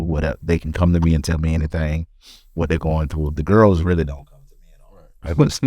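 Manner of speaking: normal talking voice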